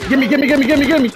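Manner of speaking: high rapid pleading; urgent begging. Real, not acted. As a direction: beg fast and high. Begging teammate during fight.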